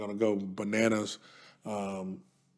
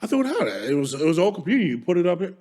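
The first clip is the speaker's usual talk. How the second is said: a dismissive wife's voice, pitched higher; imitating wife; 4 semitones higher, faster than usual. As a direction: mimic the wife brushing it off, higher and lighter. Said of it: "She says".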